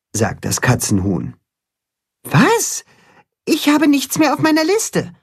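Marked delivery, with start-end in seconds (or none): 2.2-5.1 very high flustered voice; nervous alarm. A narrator's reading. Max finds nothing more on his list, nervous. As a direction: react in startled worry, pitched way up. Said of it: "Nervös blättert Max".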